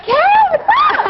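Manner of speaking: very high, drawn-out goofy hello; silly club greeting. Acted. Clowning as herself arriving at a club, silly high squeal. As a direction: squeal a slow, awkward greeting. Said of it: "you see me and I'm like".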